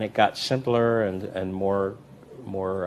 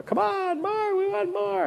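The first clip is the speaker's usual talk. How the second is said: much higher, slow crowd chant; crowd demanding more; slower than usual. Retold or imitated. Audience urging the performer back, high slow chant. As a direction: beg for an encore like an audience. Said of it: "they go".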